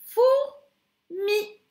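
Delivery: fourmi said high and emphatic, both syllables blended; teacher blending the rebus answer; syllables joined. Shown as a demonstration. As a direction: join four and mi into Fourmi.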